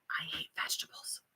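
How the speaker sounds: hushed confession, barely voiced; whispered guilty admission; whispered aside. Real, not acted. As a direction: drop to a whisper, like admitting a secret.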